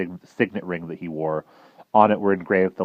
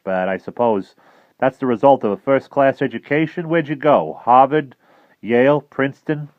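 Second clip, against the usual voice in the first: higher, playful quizzing; teasing; 7 semitones higher, 7 dB louder than usual. Acted. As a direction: rattle off colleges with a wink. Admiral teasing about colleges.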